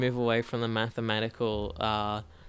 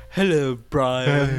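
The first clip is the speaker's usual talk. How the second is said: slow, mournful ghostly drawl; sad ghost voice; 6 dB louder, much slower than usual. Retold or imitated. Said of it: "He just sounds like a sad ghost".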